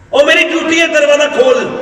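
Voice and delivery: high-pitched, urgent call; insistent demand. Retold or imitated. Umar tells woman indoors it is his duty, insistent.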